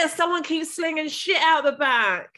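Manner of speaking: giggly mock neighbour moaning about the window. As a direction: play the nosy neighbour through a laugh. Mock neighbour complaining, laughing.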